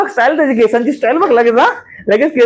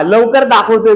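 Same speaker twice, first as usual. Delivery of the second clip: ordinary talk, nothing marked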